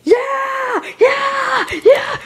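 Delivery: shrill high cheering yells; ecstatic high shrieks. Acted. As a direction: scream with giddy joy. Screaming in joy at dollar.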